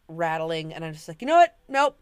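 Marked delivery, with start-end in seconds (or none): 1.2-2.0 sudden loud, high burst of refusal; retold firm refusal. Retelling the moment she quit the Zipper, loud refusal. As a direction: call it off abruptly and loudly.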